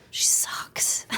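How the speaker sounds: unvoiced conspiratorial whisper, joking; mock whisper. Joking about herself to the interviewer, whispered mock insult. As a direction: breathe it like a secret dig at yourself.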